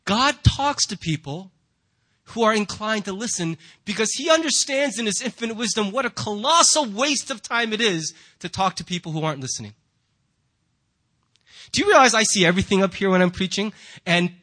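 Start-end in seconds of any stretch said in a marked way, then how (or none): none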